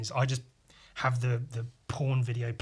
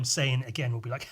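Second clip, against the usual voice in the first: ordinary talk, nothing marked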